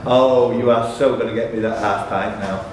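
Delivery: usual speaking voice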